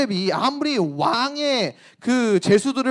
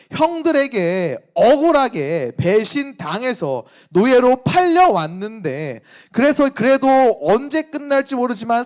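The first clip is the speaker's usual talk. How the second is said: louder, impassioned sermon voice; preaching at full force; 5 semitones higher, 5 dB louder than usual. Acted. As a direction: press the injustice home to the congregation. (힘있게 열정적으로 설교하듯)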